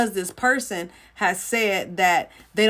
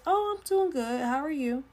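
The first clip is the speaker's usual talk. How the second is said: much higher, sugary sweet reply; fake pleasant; 6 semitones higher than usual. Preacher mimicking her polite outward reply, much higher fake sweetness. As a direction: put on a bright polite front. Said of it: "But I, on the outside, I'll say".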